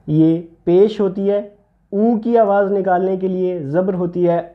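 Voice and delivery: ordinary talk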